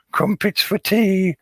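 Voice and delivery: very high-pitched caricature British voice; mock British. Mocking British tea-time cliche.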